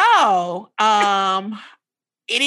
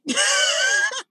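evil laugh. Real, not acted. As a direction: cackle like a gleeful villain. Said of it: "This evil laugh is like".

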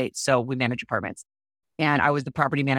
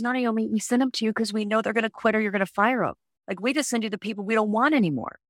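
higher, lightly voiced boss explaining; blunt manager's line, voice raised in pitch; quoting the manager; 6 semitones higher, faster than usual. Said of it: "He goes".

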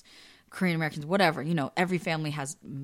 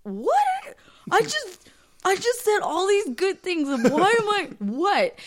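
high, indignant retold disbelief; incredulous protest; 13 semitones higher, 4 dB louder, slower than usual. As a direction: act out baffled protest, pitched way up. Retold indignant reply to dad.

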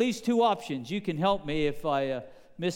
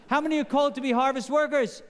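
higher and faster, with a laugh; quicker and brighter second ask through a laugh; pushing for a louder answer; 6 semitones higher, faster than usual. Shown as a demonstration. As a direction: ask again, urging the congregation to answer up. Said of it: "You sound pretty weak".

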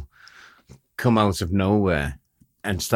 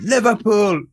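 high, loud and proud; proud exclamation; 11 semitones higher, 5 dB louder than usual. Pretend. Imagined captive announcing his team, loud and proud. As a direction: belt out the team name.

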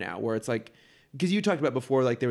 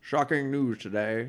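newsman voice; slower than usual. Pretend. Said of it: "That was more of Tom Brokaw".

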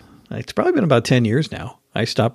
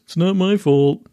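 dismissive driver; 7 semitones higher than usual. Retold or imitated. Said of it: "He goes".

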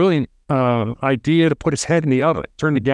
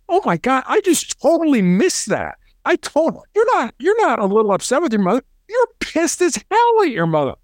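much higher, sudden "oh my God" surge; emphatic realization; 9 semitones higher than usual. Retold or imitated. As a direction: catch yourself, then hit it hard. Negotiator voicing his own realization.

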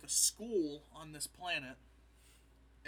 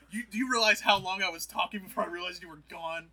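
loud indignant Palpatine; 4 semitones higher, 13 dB louder than usual. Retold or imitated. Palpatine voiced in retelling.

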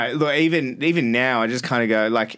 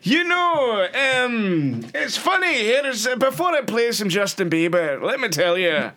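much higher mock Scottish accent, laughing; Billy Connolly impression; 10 semitones higher, slower than usual. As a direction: ham up a Glaswegian storyteller. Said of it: "Billy Connolly of radio".

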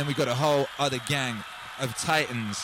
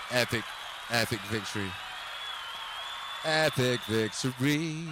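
mock epic voice; 4 semitones lower, 5 dB quieter than usual. Savoring his favorite title.

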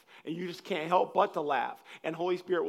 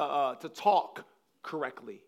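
ordinary delivery

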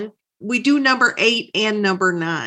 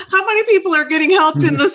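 talking through real laughter, higher and louder; co-host cracking up while teasing the guest; laughing; 6 semitones higher, 4 dB louder, faster than usual. Real, not acted. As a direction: laugh while you tease him, fast.

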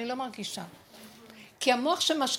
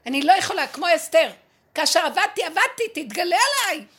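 louder, higher pleading cry to God; crying out prayer; 4 semitones higher, 8 dB louder than usual. Retold or imitated. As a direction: cry out to God, begging him to appear. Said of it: "הצעקה שלי הכניסה אותו אליי".

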